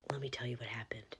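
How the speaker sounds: hushed storyteller whisper; whispered narration. A narrator's reading. Narrator whispering the setup to the viewers.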